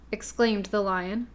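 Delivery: ordinary delivery, nothing marked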